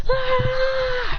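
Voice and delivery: long high-pitched acted scream; drawn-out high shriek; startled scream. A narrator's reading. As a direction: scream in fright, held high. Looks behind him, startled.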